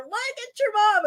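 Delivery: high-pitched mimicked teasing; teasing classmates. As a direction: imitate kids pointing out an embarrassing parent, high. Classmates teasing her kids.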